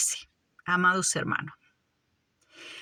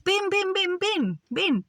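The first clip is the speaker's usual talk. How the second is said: put-on higher-pitched calls, beckoning a toddler; imitating a coaxing father; 8 semitones higher, slower than usual. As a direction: coax a child to walk, repeating each call. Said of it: "como ese padre, cuando le dice al niño". (imitando a un padre que anima al niño)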